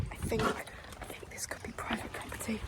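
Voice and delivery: hushed whisper, sneaking away. Real, not acted. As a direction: whisper so nobody hears. Sneaking off private land.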